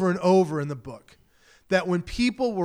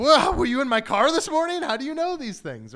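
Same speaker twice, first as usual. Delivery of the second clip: much higher, amazed "whoa"; mock astonishment; 8 semitones higher, 4 dB louder than usual. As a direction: act floored that a stranger read your mind. Stunned by a stranger's insight.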